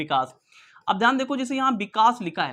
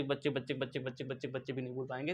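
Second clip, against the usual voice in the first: fading repetition; 7 semitones lower, 13 dB quieter, faster than usual. Acted. Playful exaggeration of generations.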